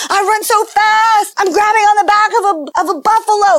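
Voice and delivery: toddler playing Mowgli. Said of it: "pretending she was the Jungle Book kid".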